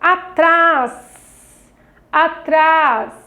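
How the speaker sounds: ATRÁS with TRÁS held long; syllable stress demo. Shown as a demonstration. Teacher sounds out the word for children, Atrás stressed on TRÁS. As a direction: lean hard on the end of Atrás. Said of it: "ler com maior intensidade a palavra".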